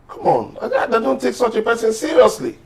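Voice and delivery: high, scoffing laugh through the words; real exasperated scoff, pitched high; incredulous laugh. Real, not acted. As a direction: laugh off the very idea. Heated panel argument, dismissive.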